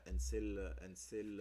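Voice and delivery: normal talking voice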